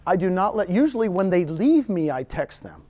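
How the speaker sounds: ordinary talk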